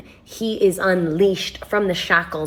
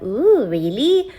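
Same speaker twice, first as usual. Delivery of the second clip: drawn-out, higher 'ooh, really'; slow, raised-pitch mock intrigue; intrigued reaction; 5 semitones higher, 3 dB louder, much slower than usual. Replaying reaction to the stranger, mock intrigued.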